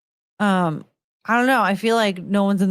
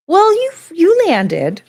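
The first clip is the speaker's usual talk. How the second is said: high, loud, slow praise for the dog; delighted sing-song to a pet; excited praise; 8 semitones higher, 7 dB louder, slower than usual. Real, not acted. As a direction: cheer the pup's landing. Praising dog after jump.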